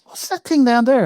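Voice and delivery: high, lightly voiced curious host; Michael Parkinson imitation. Talk show host asks the lighting man about the strip light, high mock curiosity.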